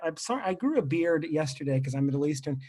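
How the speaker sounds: ordinary delivery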